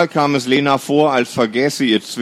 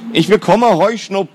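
higher and louder, insisting; a brother repeating himself louder to his sister; 9 semitones higher than usual. Acted. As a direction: say it again, louder this time. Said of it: "deutlich lauter".

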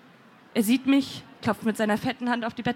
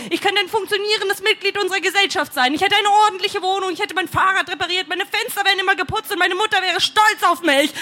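raised pitch, building toward a scream; exasperated rant; 6 semitones higher, 4 dB louder than usual. A narrator's reading. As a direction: pile up the grievances, higher and more desperate. Verzweifelte Klage, steigert sich. (verzweifelte, sich steigernde Tirade)

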